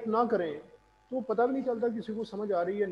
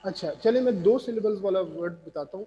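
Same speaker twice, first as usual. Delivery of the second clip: normal talking voice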